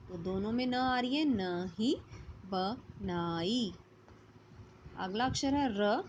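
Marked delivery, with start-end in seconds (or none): 2.5-3.7 बनाई stretched very slowly, lower; drawn-out word demo. Shown as a demonstration. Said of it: "न से अक्षर लिखेंगे नहीं, बनाई". (बनाई को बहुत धीरे खींचकर)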